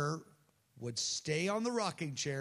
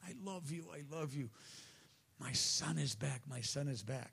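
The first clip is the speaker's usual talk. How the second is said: very soft, tender father; hushed, overwhelmed affection; affectionate; 7 dB quieter than usual. Acted. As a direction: murmur it lovingly to a returned son. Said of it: "falls on his neck with affection".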